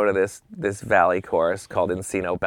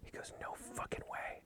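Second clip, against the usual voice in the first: very soft, breath-only whisper; whispered refusal; 26 dB quieter than usual. Retold or imitated. Buddy reacting to the sight of O J, whispered. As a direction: refuse it under your breath.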